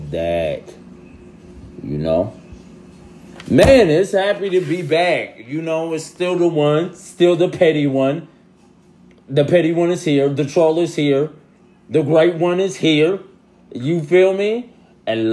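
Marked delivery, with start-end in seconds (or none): 3.5-14.7 higher, louder hype boast; hyped return. Real, not acted. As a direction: announce your comeback with swagger.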